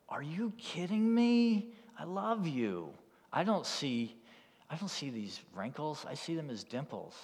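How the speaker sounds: acted amazed devotion; incredulous affection. Groom's astonished loving reply, acted.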